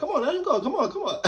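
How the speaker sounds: put-on high insistent girl pleading with mom; pushy little girl. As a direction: beg mom to let him go.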